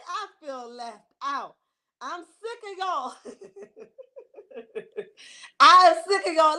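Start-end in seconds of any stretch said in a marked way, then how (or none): 2.0-4.7 laughing it out, slow and teasing; playful complaint through a laugh. Real, not acted. Host teasing chat viewers while laughing. 5.6-6.6 loud, playful mock outrage; mock yell. Host mock-yelling at viewers who hid their page. Repeats the complaint much louder.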